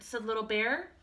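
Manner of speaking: usual speaking voice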